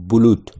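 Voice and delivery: بلوت with ordinary short u sounds, no doubled letter; plain normal u. Shown as a demonstration. Teacher showing students the plain way to say بلوت. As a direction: say بلوت evenly as bu-lut, nothing stretched. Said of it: "فقط عادی نرمال بلوت".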